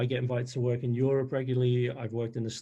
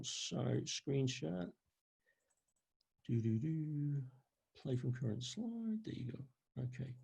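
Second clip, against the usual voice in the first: muttering to self; 10 dB quieter, slower than usual. Real, not acted. Muttering to himself while screen sharing.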